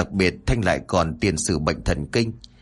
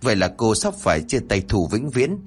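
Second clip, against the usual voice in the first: usual speaking voice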